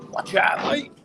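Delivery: put-on annoyed shout in a Cockney accent; mock Cockney shout. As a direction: yell it like an irritated Cockney. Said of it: "Not in a Cockney accent".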